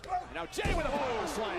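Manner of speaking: excited ringside call; wrestling commentator. Real, not acted. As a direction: call the move with rising energy. Live play-by-play call.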